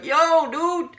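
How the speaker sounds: high, loud call of yo dude; yelling out. Retold or imitated. Inmates yelling at Terry in the cell, loud call. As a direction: holler it across the block.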